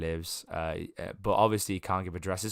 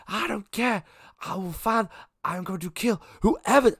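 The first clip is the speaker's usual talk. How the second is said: furious, much higher and louder; aggressive; 12 semitones higher, 6 dB louder than usual. Acted. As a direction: snap out a threat to kill whoever. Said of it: "And it's very aggressive".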